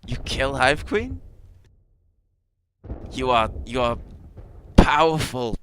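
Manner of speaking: high, loud creature voice full of amazement; halting, wonderstruck insect creature; awed creature. Acted. Creature learning you killed its queen, high awestruck voice. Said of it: "And he goes".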